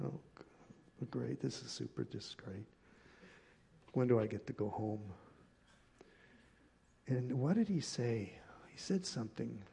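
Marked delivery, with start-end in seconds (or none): 0.0-5.0 low, soft sarcastic grumble; sarcastic dread. Retold or imitated. Reluctant visitor privately wishing to go home, sarcastic dread. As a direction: complain under your breath, much softer. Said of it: "And I'm going".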